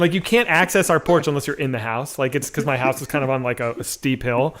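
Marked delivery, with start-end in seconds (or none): none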